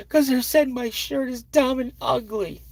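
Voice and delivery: put-on high, hurt child's voice; upset little girl. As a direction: tattle like a wounded second-grader. Daughter complaining.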